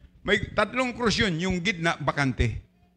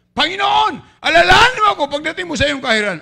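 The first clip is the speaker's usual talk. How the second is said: much higher, shouted plea to the Lord; pleading cry; 9 semitones higher, 7 dB louder than usual. Acted. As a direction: beg to be remembered. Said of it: "sabi niya".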